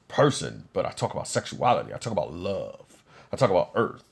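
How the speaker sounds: low, softened voice of a self-praising singer. Acted. Singer setting himself apart from other artists, low impression. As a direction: play the singer deep and low, boasting. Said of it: "that's different from the voice".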